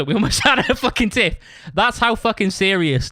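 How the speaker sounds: high laughing burst mid-sentence. Real, not acted. Telling how he nearly fell out with Neil, laughing. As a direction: break into a laugh at the absurdity.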